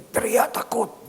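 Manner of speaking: high, loud, stretched exclamation; mock scream. Preacher describing the sick man's terror, high and loud. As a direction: raise it sharply like a retold scream. Acting out the terrified cry.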